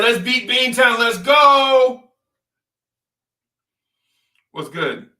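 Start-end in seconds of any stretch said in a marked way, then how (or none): 0.0-2.0 Randall hyped. Retold or imitated. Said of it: "Randall told me, said".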